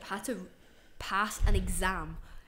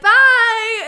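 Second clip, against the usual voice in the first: loud mock goodbye; 14 semitones higher, 20 dB louder than usual. Retold exit, very loud high.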